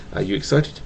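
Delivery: usual speaking voice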